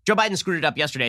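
ordinary delivery

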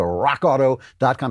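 "rock auto dot com" with ROCK rolled out dramatically; showy brand-name delivery. Shown as a demonstration. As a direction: relish "rock auto dot com", drawing out the first word. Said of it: "You gotta say it like that".

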